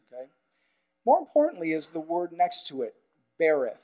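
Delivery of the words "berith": usual speaking voice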